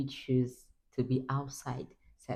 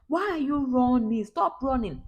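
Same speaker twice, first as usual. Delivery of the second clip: higher, louder calling out to stop; calling to son; 11 semitones higher, 5 dB louder than usual. Retold or imitated. Mother calling after her running son, scolding loudly. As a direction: call after a running child. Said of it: "I'm like".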